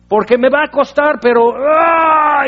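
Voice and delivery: grito de esfuerzo. Acted. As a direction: push through it with a straining yell. Acting out straining effort. (grito largo y agudo de esfuerzo)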